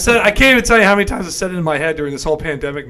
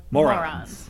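Vizzini impression; 4 semitones lower, 5 dB quieter than usual. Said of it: "Just the way Vizzini says it".

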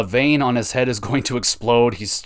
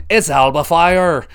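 loud, higher mock Scottish accent, slowed down; imitating Scottish accent; 7 semitones higher, 8 dB louder, slower than usual. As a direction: ham up a thick Scottish accent for the wrestler. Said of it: "the most Scottish voice of all time".

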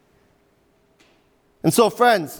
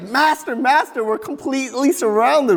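higher, urgent cry of a frightened servant; panicked servant; 6 semitones higher than usual. Acted. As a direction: sound like a servant who sees the enemy army. Servant panicking, surrounded by army.

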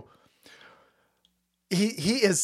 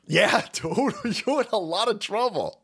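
ordinary talk, nothing marked

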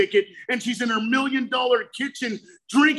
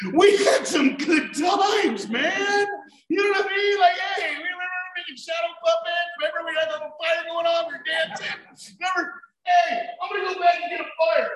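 excited nostalgia; 7 semitones higher than usual. Retold or imitated. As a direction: hype up the good old days. Nostalgic excited memory of fire.

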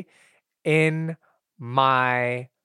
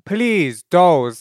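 ordinary delivery

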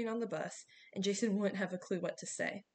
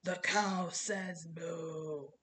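very slow, drawn-out announcement; blaring megaphone voice; 3 semitones lower, much slower than usual. A narrator's reading. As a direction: imitate a malfunctioning megaphone toy voice. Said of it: "this time the megaphone blared".

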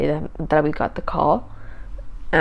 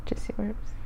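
playful hushed whisper; 13 dB quieter than usual. Real, not acted. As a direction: breathe it out teasingly. Whispered echo of joke.

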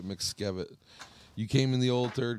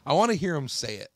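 normal talking voice

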